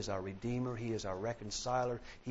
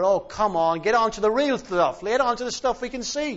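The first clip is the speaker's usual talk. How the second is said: high, loud, exasperated yell from the crowd; a bidder hollering to skip ahead; impatient heckler shout; 11 semitones higher, 13 dB louder than usual. Retold or imitated. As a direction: shout him down, fed up. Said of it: "And somebody shouted".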